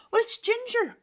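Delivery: high, loud excited shout; excited call out. Acted. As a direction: call it across the room with delight. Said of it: "someone called out".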